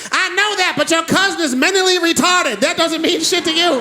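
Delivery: lightly voiced grandmother arguing; grandma arguing. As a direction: snap back like grandma. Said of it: "on the phone arguing".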